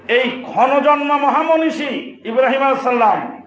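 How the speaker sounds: ordinary talk, nothing marked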